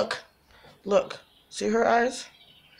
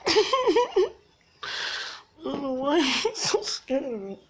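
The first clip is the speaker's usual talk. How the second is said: tearful laughter breaking into words; laughing so hard it sounds close to crying; 5 semitones higher, slower than usual. Real, not acted. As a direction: squeeze the words out through laughter. Laughing fit.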